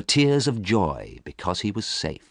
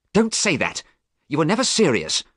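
mild, higher angry retort; 10 semitones higher, 3 dB louder than usual. A narrator's reading. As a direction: push back, a touch stung. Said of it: "said Dorian angrily".

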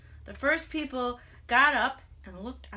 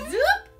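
very high, quick rising zoop; a wand whisking something away; magic zoop sound; 9 dB louder than usual. Retold or imitated. Magician father making things vanish, zoop.

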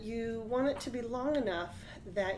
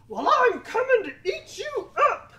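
much higher, loud troll threat; 10 dB louder than usual. Acted. Troll threatens the biggest goat, loud and high. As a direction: shriek the threat like a wild troll.

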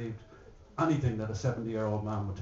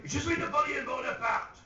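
grand Napoleon claim; 12 semitones higher than usual. Acted.

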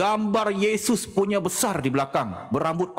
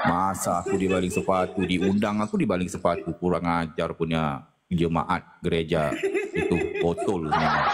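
laughing grumble; 10 semitones lower than usual. Real, not acted. Low laughing grumble about congregation.

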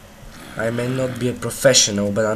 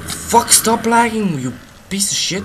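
angry at lag; 10 semitones higher, 6 dB louder than usual. Real, not acted. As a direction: drag out the curse at the frozen screen. Raging at the lagging game.

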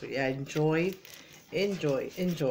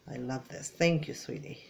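ordinary talk

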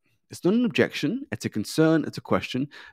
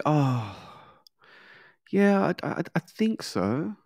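hesitant agreement; 4 semitones higher, slower than usual. Acted. Client's unsure answer acted out.

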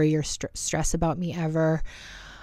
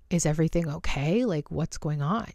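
usual speaking voice

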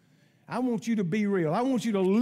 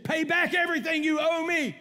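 higher-pitched, forceful imitation of a creditor; retold demand; 6 semitones higher than usual. Servant choking his debtor for money, acted-out demand. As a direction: press the demand as a storyteller would. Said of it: "He demanded it".

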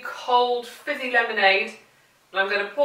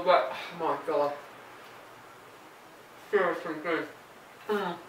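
quieter while chewing; mouth full; 5 semitones lower, 12 dB quieter than usual. Real, not acted. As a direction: savour it through a mouthful. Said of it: "Don't talk with your mouth full".

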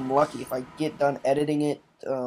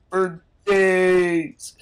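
loud, higher outburst; exasperated shout; 4 semitones higher, 7 dB louder than usual. Acted. Messenger who tried to reach the player, exasperated shout. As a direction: belt it out in mock desperation. Messenger stressing long wait.

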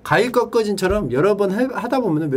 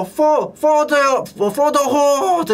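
high, drawn-out mock battle cry; 9 semitones higher, 4 dB louder, much slower than usual. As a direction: lead the crowd's chant, stretching each word. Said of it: "막 소리쳐, 누가 막 선창하면". (높고 길게 끌며 선창하는 흉내)

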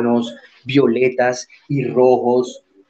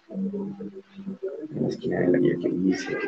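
softer and slower, drawn out to a rising end; quiet slow repeat; 4 semitones lower, 10 dB quieter, slower than usual. Shown as a demonstration. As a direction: repeat it gently and slowly as a reminder to yourself. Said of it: "teacher repeats the phrase".

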